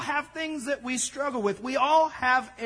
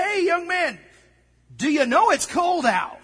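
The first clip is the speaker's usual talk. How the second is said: raised, higher call down the street; 7 semitones higher, 6 dB louder than usual. Retold or imitated. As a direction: call out to the kid across the street. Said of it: "decided to call out to him".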